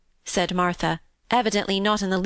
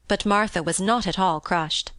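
ordinary delivery

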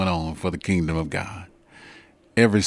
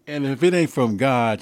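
ordinary talk

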